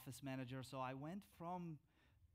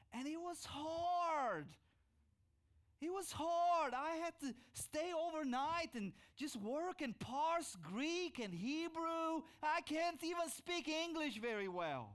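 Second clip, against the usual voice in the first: loud, high, drawn-out cry; fervent preaching; 11 semitones higher, 8 dB louder, slower than usual. Real, not acted. Pastor telling the congregation his studies were hard, loud and fervent. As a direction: shout the struggle out to the congregation.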